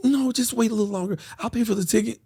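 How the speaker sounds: put-on high, placating girlfriend voice; high mock-woman reassurance; imitating woman friend. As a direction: coax him in her high voice.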